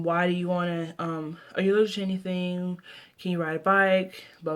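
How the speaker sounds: ordinary delivery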